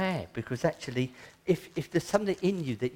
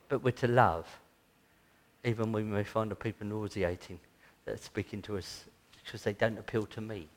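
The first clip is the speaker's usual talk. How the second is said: ordinary delivery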